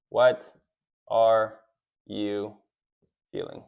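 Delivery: each word spaced out, fading to near silence; slow, word by word. Shown as a demonstration.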